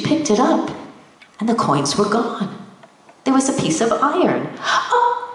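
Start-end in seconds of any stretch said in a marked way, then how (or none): 4.9-5.3 delighted oh. Acted. Old woman exclaims at iron.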